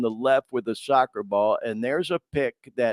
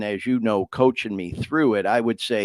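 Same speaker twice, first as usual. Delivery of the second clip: ordinary talk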